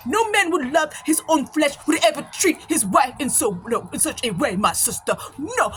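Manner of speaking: indignant imitated African accent, pitched high; mock African-accented sermon; African brother's accent. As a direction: preach it like an African brother, each word weighty. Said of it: "He said".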